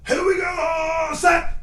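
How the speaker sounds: high, slow drawn-out call; quarterback cadence shout. Pretend. Player calling cadence before a throw, high drawn-out shout. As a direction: stretch GO out high, then punch SET.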